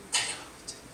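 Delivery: 달려가고 있잖아요 whispered, breath only; whispered echo. Real, not acted. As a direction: drop to a whisper to let it sink in. Whispered echo of previous line. (속삭이듯 되풀이)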